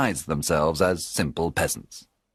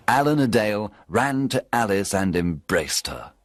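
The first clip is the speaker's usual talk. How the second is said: plain reading voice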